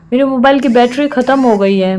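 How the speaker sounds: ordinary talk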